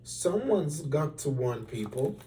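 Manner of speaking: usual speaking voice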